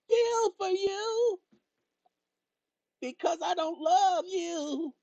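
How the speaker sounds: high, drawn-out fake crying; hammed-up tearful whine; mock wail. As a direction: stretch it out in a high, put-on sob. Mock wailing that he feels nothing.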